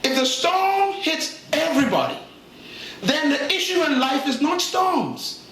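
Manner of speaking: slow, drawn-out emphatic preaching; slow restatement. Shown as a demonstration. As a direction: say it slowly, stretching each phrase so it sinks in. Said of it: "Let me say it slow".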